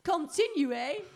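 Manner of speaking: high, slow put-on French; mock French accent. Host urging the co-host on, in mock French. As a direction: stretch it out in playful French.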